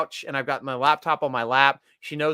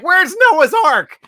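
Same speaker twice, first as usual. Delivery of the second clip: mock shout; 12 semitones higher, 9 dB louder, slower than usual. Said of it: "I'm like".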